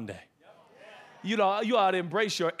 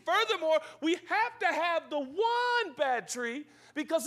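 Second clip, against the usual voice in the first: raised, insistent pulpit argument; emphatic preaching; 10 semitones higher than usual. Real, not acted. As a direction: press the point home with conviction. Preaches at full force.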